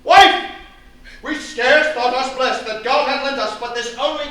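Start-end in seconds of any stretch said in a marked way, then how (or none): 0.0-0.5 loud, high call of "Wife"; angry father shouting for his wife; bellowing call. Acted. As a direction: bellow across the room.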